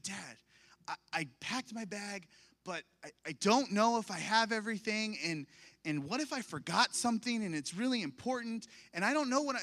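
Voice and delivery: put-on high, rushed, panicky child's voice; panicked daughter. Daughter panicking to her dad the night before the trip. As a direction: spiral into worry like a scared kid. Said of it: "she was in a complete panic".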